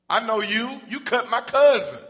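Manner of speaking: loud, high accusation; shouting at Peter, you did it; accusing servant. Acted. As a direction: call him out in front of everyone.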